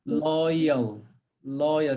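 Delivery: "loyal" joined up, low falling tone; careful model pronunciation. Shown as a demonstration. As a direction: say "loyal" slowly and clearly as a model. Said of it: "separate the word into two parts".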